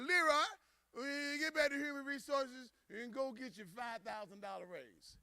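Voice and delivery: high voice falling away softly; high-pitched boss placating an employee; acted tipsy executive, softening as he goes; company president. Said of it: "The president walked over to Leroy".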